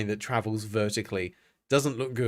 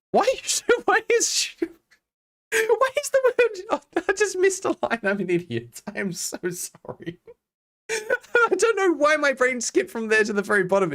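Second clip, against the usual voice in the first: giggly squeak. Real, not acted. Laughing at own misread line.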